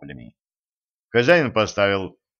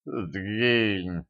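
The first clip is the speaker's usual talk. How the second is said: muffled, feeble dismissal; weak mumble from a battered fighter; barely moving jaw; 4 dB quieter than usual. A narrator's reading. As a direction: say it through a jaw that hardly moves. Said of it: "едва шевеля челюстью".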